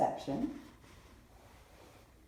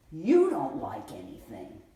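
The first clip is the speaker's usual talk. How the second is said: slow, louder accusing grandma; grandma fighting back; 10 dB louder, slower than usual. Retold or imitated. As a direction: throw it back at her, slowly. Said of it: "Grandma comes out fighting".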